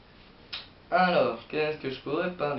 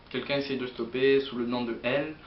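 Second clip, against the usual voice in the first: ordinary talk, nothing marked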